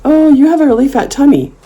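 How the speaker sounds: put-on high-pitched, guileless child voice; imitated kid. Imitating a kid commenting on her body, innocently. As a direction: blurt it like a little kid. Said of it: "kids will say things".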